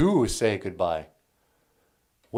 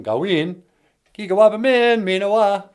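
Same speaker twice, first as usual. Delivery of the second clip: "Gawin" put up front, then "kigawaba min minawa" rising high and loud; stress on Gawin; 8 semitones higher, 5 dB louder than usual. Shown as a demonstration. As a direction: lead with "Gawin" and lift the rest. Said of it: "if you add a gawin at the beginning".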